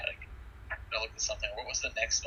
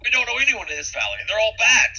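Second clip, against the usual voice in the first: angry; 10 semitones higher, 8 dB louder than usual. Acted. Soldier answers strangers angrily.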